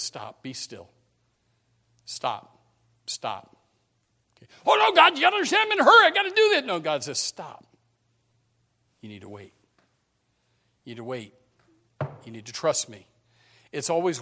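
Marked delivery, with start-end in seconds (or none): 0.0-4.5 gently but firmly say stop; calm and firm. Retold or imitated. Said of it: "sometimes God just says". 4.7-6.7 whiny hurried pleading. Retold or imitated. 6.7-13.1 gently but firmly say stop; calm and firm. Retold or imitated. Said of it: "sometimes God just says".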